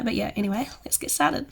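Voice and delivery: normal talking voice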